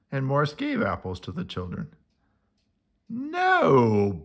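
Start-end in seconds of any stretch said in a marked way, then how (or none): 3.1-4.2 louder no; cried out. A narrator's reading. Boris stopping Morris at the apple game, sharp cry. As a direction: stop him with a cry. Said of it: "Boris cried".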